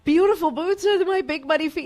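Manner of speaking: high, slow, near-tearful gushing; flustered fan apology, high and drawn out; mortified apology. Retold or imitated. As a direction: squeal sorry at the star. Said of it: "I said".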